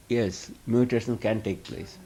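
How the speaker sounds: usual speaking voice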